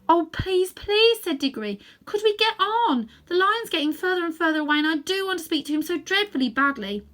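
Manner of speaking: put-on high, loud urgent boy's plea; pleading boy. A narrator's reading. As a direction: beg to hurry on. Diggory begging urgently.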